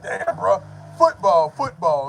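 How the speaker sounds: loud mock scold, football yelled; mock scolding Big J. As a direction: correct him loudly like an annoyed Brit. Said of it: "Big J probably cussing my ass out".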